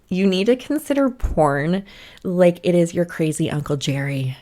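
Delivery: usual speaking voice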